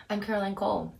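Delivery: ordinary talk, nothing marked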